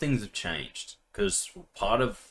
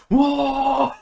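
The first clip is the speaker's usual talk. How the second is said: loud, high "whoa"; retold exasperated yell; 9 dB louder than usual. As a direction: burst out with a high frustrated yell. Acted outburst in retold frustration.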